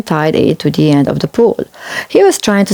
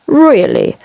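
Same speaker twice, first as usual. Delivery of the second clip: huffy; 7 semitones higher than usual. A narrator's reading. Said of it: "huffed James".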